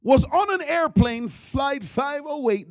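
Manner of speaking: ordinary delivery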